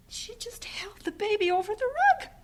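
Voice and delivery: high hushed whisper; horrified, barely said; shocked whisper; appalled whisper. Acted.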